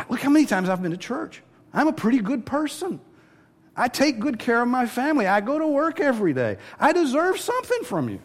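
high, bragging self-righteous voice; mock someone listing their merits; smug churchgoer. Preacher mocking churchgoers, high smug bragging voice.

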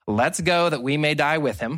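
ordinary delivery

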